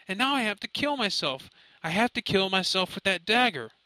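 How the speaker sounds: high, rushing, panicked pleading; a scared son spilling out a nightmare he can't shake; frantic fear. Acted. Son frantically telling his mother about the dagger, panicked.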